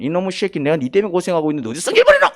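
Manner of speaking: hurried scolding building to a yell; mock temper outburst. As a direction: lose your temper as an acted parent. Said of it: "그럼 성질 부리면".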